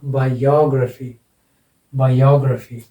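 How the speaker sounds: "Biography" as bai-YOG-ruh-fee, falling after "यो"; stress on YO. Shown as a demonstration. Teacher gives the pronunciation to students, stress on YO. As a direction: lean hard on "यो" in "biography". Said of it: "इसका उच्चारण होगा".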